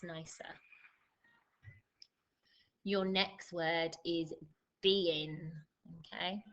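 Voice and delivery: slow, measured word-by-word dictation; spelling-test dictation. Acted. Teacher giving pupils their spelling test, slow dictation. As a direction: read out each test word slowly, with pauses.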